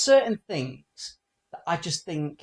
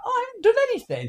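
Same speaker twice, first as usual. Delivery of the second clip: much higher, fast, playing the guilty dog; squeaky protest of a dog caught out; innocent dog voice; 6 dB louder, much faster than usual. Voicing the dog blamed for the fart, high and quick. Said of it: "she kinda looked at me like".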